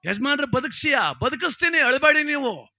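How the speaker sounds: high, loud excited exchange; loud back-and-forth. Acted. Widow and Dasappa loudly argue about reviving the dead. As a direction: call it out over the noise.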